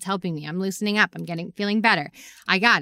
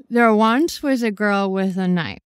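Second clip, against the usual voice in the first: slow, deliberate limerick; slow dramatic limerick; poem recital; 2 semitones higher, slower than usual. Acted. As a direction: recite slowly, every word weighed. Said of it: "She goes".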